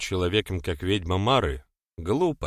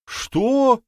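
shocked; 6 dB louder than usual. Acted. Both women shocked by order.

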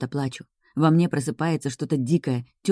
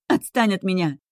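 higher shriek full of pain; 5 semitones higher than usual. A narrator's reading. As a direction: let the voice squeal in anguish. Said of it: "Кричу, и в голосе слышен визг".

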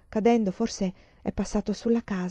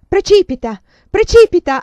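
loud and higher, building to a terrified scream; panicked scream; 10 semitones higher, 15 dB louder than usual. A narrator's reading. Emanuela in her nightmare of falling, a building terrified scream. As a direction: scream it as the ground rushes up.

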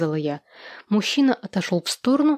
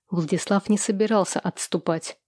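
usual speaking voice

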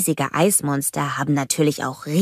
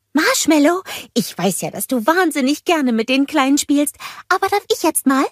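cheery greeting; 9 semitones higher than usual. A narrator's reading. Olaf greeting the snowgies, high and happy. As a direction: call out warmly to the little ones. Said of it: "fragte Olaf".

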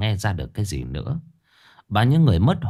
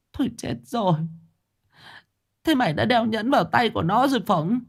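1 horrified; 13 semitones higher than usual. A narrator's reading. Mother horrified.

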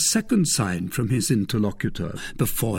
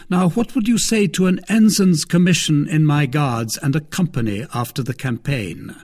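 lightly voiced stately cardinal, measured; a hint of a lofty cardinal; 6 semitones higher, 4 dB louder than usual. A narrator's reading.

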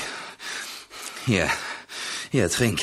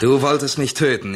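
louder and higher, a hard accusation; angry accusation; 6 semitones higher, 5 dB louder than usual. Acted. Betrayed comrade confronts the man who shot at him, angry. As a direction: throw it right in his face. Accuses his would-be killer.

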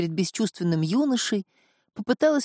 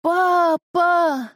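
high, loud cry for papa; exclaimed gasp; 11 semitones higher, 5 dB louder than usual. A narrator's reading. Daughter sees her father coming home, high outcry. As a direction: burst out with the word in a high voice. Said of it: "выдохнула Снежана".

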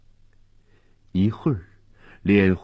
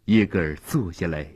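ordinary delivery, nothing marked

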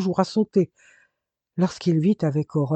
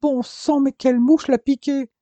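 much higher, louder exclamation; alarmed outburst; 9 semitones higher, 6 dB louder than usual. Acted. Mother exclaims over the reckless boy, high alarmed outburst. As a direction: burst out in alarmed exasperation.

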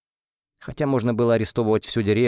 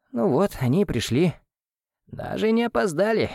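hoarse; 10 semitones higher than usual. Acted. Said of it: "прохрипела Дарья с пола".

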